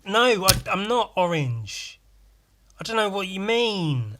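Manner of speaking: high, protesting bafflement; indignant confusion. Acted. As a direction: object in comic exasperation. Acted baffled reply to the joke.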